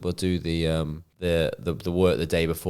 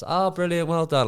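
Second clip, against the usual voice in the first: high, flat-cheered well done, way up; a wife who doesn't get it, chirping high; unimpressed wife; 11 semitones higher than usual. Retold or imitated. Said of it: "she was like".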